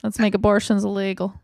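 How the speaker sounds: put-on Texas oil baron accent. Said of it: "did I do the accent right?".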